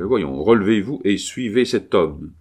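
ordinary delivery

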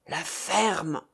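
growled, drawn-out order to shut up; low growl. A narrator's reading. Casimir silences the chattering traveller, growling. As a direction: growl it under the voice, changed timbre. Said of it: "gronda monsieur Casimir".

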